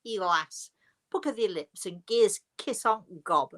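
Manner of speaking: playful broad Yorkshire dialect; mock-Yorkshire, words run together; terrible Yorkshire accent. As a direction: put on a bad Yorkshire accent. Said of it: "terrible Yorkshire accent".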